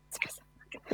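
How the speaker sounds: playful whispered no; whispered tease to the guessing players; teasing whisper. Real, not acted. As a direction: breathe it out like a secret. Host teasingly whispers the wrong answer.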